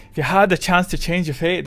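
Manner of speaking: playful, emphatic Scottish impression; put-on Scottish accent. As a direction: throw out a saying in a Scottish accent. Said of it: "quick, come up with a Scottish saying".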